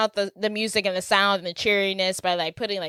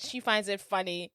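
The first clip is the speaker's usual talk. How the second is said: ordinary delivery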